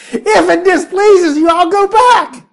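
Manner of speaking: high, loud, melodramatic plea; dramatic whine. Retold or imitated. Balaam pleading to the angel, a high hammed-up whine. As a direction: wail it like a wounded martyr. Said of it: "how could you say it any more dramatically".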